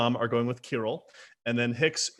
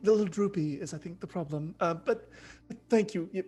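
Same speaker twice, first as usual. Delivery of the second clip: high, stammering self-consciousness; high-pitched, hesitant society girl; fretful young sister Valerie; 7 semitones higher, faster than usual. Acted. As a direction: fluster over your outfit in a raised voice. Plays Valerie, fretting then grateful.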